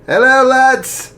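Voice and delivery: high, loud, slow hearty Irish greeting; jolly archbishop. Acted. Jolly archbishop greeting the boys warmly, loud. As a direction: greet the lads cheerfully. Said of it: "Archbishop Miller smiled".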